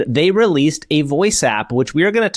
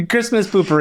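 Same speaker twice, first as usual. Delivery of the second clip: laughing; 6 semitones higher than usual. Real, not acted. Laughing at poopery joke.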